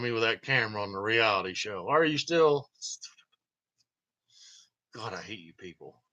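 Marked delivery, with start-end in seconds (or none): none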